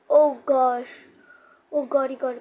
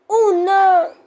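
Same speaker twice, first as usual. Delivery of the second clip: panicked cry; 4 semitones higher, 12 dB louder, much slower than usual. Real, not acted. Monster attack panic.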